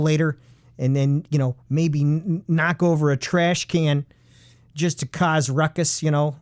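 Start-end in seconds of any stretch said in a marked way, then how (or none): none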